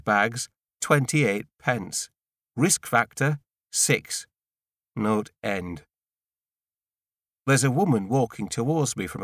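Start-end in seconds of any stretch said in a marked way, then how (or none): none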